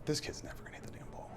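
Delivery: hushed aside, half under breath; inner thought whispered. Retold or imitated. Pitcher thinking to himself during the at-bat, whispered. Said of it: "I was thinking to myself".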